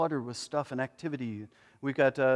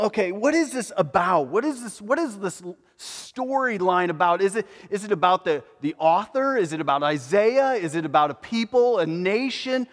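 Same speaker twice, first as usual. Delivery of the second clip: eager questions; 5 semitones higher, 5 dB louder than usual. Pretend. Eunuch's questions voiced.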